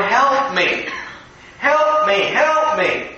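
mock cries for help, voice lifting; scared child. Little boy sitting in the stream calls for help, mock scared. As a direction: plead like a little boy in trouble. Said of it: "because he was scared".

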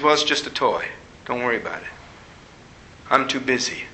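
preoccupied father's low brush-off; dismissive dad. Retold or imitated. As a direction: brush the child off, low and quiet. Dad brushing off child's complaint.

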